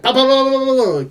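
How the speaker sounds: imitated pulse rifle spray; rifle fire noises. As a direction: rattle off bah bah like a rifle.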